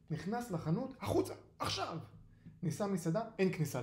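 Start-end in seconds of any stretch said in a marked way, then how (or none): 1.0-2.0 high, indignant command to leave; sharp order. Acted. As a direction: order an intruder out, pitched high. Shopkeeper throws the cat out.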